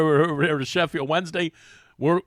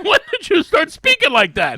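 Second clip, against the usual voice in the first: much higher, louder disbelief; disbelieving; 14 semitones higher, 10 dB louder than usual. Retold or imitated. Asking his teammate about his new accent, stunned and loud. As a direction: blurt it out, stunned.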